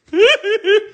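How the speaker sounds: high, loud giggle; nervous laugh. Real, not acted. Nervous about opening the box.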